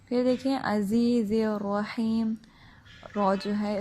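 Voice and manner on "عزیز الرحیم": slow "عزیز الرحیم" with drawn-out ee; lengthened ee. Shown as a demonstration. Teacher showing the two-second stretch, "عزیز الرحیم" held long. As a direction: chant "عزیز الرحیم" holding each long ee. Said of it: "دو second لمبا کریں گے".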